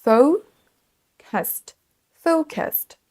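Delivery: focused broken down as FOH, then kəst; schwa pronunciation. Shown as a demonstration. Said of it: "C'est quasiment comme un son E". (focused avec un E à peine perceptible)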